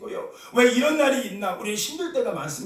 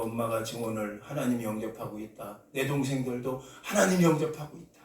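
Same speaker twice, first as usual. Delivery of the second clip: faint voice; 10 semitones lower, 6 dB quieter than usual. Retold or imitated. Dying girl's faint words.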